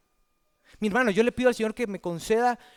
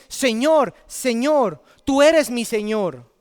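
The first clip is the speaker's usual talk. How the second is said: loud, high, insistent pleading; preacher acting out a desperate appeal; pleading virgins; 4 semitones higher, 5 dB louder than usual. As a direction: beg to be let in as someone who belongs. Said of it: "No, ellas dicen".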